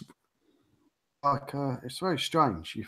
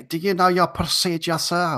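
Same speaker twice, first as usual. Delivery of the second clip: put-on Eastern European accent; 7 semitones higher than usual. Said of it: "Eastern European accent".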